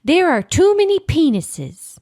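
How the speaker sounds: high-pitched plea; pleading. Acted. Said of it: "with a plea".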